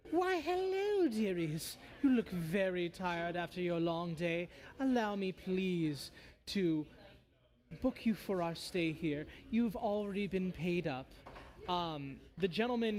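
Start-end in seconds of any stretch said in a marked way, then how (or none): 0.1-11.1 put-on voice of a polite fiend; bone devil. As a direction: greet them with oily, theatrical politeness. Game master voices bone devil.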